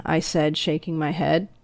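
usual speaking voice

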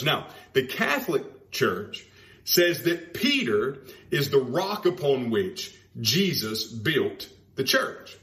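ordinary talk, nothing marked

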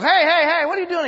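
shocked barman shouting; startled bartender. Acted. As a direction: yelp it out like a man just splashed. Bartender startled by thrown beer.

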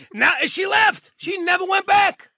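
high, drawn-out exclamation; emphatic shout. Real, not acted. Emphatic climax of grandmother story.

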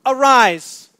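loud, falling command; stern wake-up order; commanding call. Acted. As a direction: bark it down at him. Captain's first word to Jonah.

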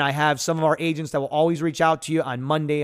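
ordinary talk

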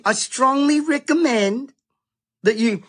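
a lightly voiced mock premier. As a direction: ape a politician's careful advice. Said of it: "Palaszczuk now is advising".

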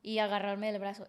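normal talking voice

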